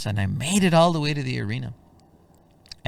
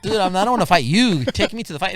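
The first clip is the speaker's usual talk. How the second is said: exasperated; 9 semitones higher, 7 dB louder, faster than usual. Retold or imitated. Correcting cab driver, frustrated.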